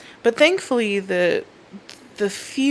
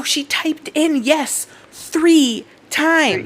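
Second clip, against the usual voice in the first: incredulous, high and slow; 7 semitones higher, much slower than usual. Real, not acted. Outraged retelling.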